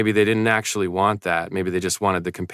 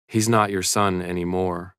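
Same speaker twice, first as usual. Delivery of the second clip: ordinary delivery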